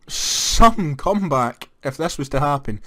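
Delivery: ordinary talk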